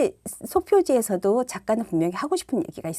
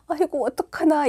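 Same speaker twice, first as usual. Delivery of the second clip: higher, mournful storybook lament; sad reading voice; 7 semitones higher than usual. Retold or imitated. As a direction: read it like the saddest page. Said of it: "슬픈 목소리로". (슬픈 목소리로 읽어주듯)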